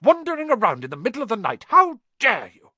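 furious scolding. Acted. McGonagall scolding Malfoy for wandering at night, furious. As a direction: scold him, rising to fury. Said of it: "she shouted".

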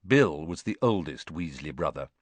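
usual speaking voice

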